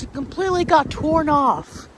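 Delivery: ordinary talk, nothing marked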